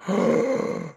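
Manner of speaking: sharp, loud intake of breath; shocked gasp. Retold or imitated. Player retells walking in on crying teammates, shocked gasp. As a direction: gasp in mock horror.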